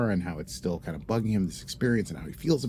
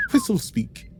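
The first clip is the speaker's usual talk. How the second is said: pitched high and sung out; sing-song title; 12 semitones higher, 4 dB louder than usual. Acted. Host repeating the episode title in a high flourish. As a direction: announce Whistle Speak with a high flourish. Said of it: "entitled Whistle Speak".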